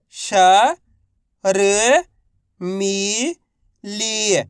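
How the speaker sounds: राजनीतिक drawn out one syllable at a time. Shown as a demonstration. Teacher sounding out राजनीतिक for learners. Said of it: "तो बताइए मिलके क्या बनेगा".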